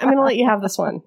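ordinary delivery

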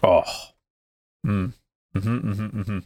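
savoring moans; appreciative hums over a sip; rapturous tasting food. Real, not acted. First sip of a great beer.

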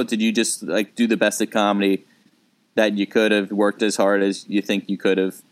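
ordinary talk

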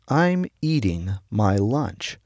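slow, easygoing, in no rush; slow and unhurried. Retold or imitated. Brother answering the rushed eater, slow and unhurried. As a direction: lay each word down at leisure. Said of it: "slid down very slowly".

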